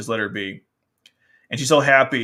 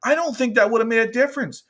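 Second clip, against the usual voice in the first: mock retort; 11 semitones higher, 5 dB louder than usual. Rebutting a character, mock voice.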